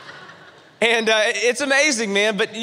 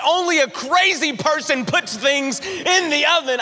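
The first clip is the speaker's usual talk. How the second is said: indignant comeback pitched higher; mock indignant retort; 7 semitones higher than usual. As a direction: fire back at the wife, higher and defiant.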